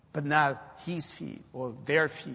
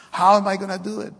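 louder, higher, quicker retold plea; mock anxious; 5 semitones higher, 9 dB louder, faster than usual. Scared would-be worker asks how he will do it, mock anxious. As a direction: imitate a worried man pressing the question. Acting out a worried church member.

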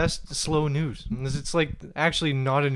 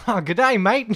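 mock Australian accent; 6 semitones higher, 6 dB louder than usual. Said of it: "that's an unusual accent".

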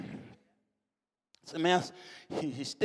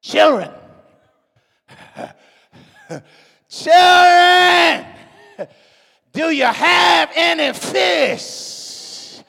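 Jesus calling; 8 semitones higher, 8 dB louder, much slower than usual. Retold or imitated. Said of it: "Jesus says".

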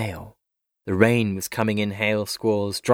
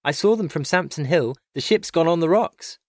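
high-pitched urgent news; breathless alarm; 7 semitones higher than usual. A narrator's reading. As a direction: report it urgently, pitch raised.

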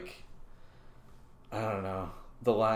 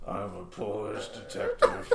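dying Rutger Hauer. Acted. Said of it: "a dying Rutger Hauer giving his last performance".

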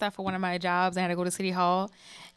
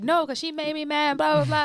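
high-pitched retold complaining; mock indignant protest; 7 semitones higher, 3 dB louder than usual. Sister retelling her protest at being told to let it slide, mock indignant. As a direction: protest up high, ending in louder blahs.

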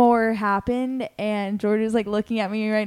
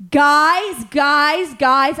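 loud raised call across the house; 5 semitones higher, 7 dB louder, much slower than usual. Retold or imitated. Calling the roommates from the couch, loud alarmed call. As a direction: call for them, louder each time.

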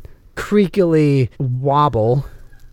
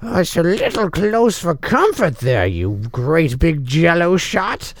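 character voice; 6 semitones higher than usual. Acted. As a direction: toss off a cheeky insult in character. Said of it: "I go".

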